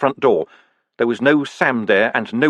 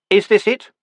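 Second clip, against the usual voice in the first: higher, louder demand; insisting; 10 semitones higher, 4 dB louder than usual. A narrator's reading. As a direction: demand a straight answer. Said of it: "he insisted".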